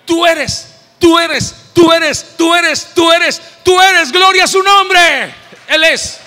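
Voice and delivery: building to full shouted praise; rising shout. Real, not acted. Preacher crying out praise to God, full shout. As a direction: preach it at the top of your voice.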